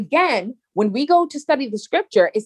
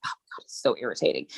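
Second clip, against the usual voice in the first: mock irritation; 12 semitones lower, 8 dB quieter, faster than usual. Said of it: "I got really angry".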